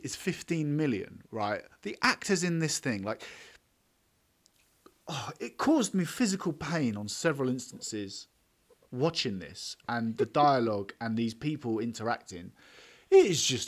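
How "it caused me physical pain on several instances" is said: usual speaking voice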